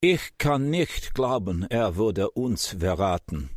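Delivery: lightly voiced Eric, upset in German. A narrator's reading. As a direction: plead in disbelief, in German. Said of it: "lapsing into German".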